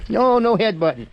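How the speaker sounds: high loud no to a goat; sharp scolding. Real, not acted. Goat headbutting him.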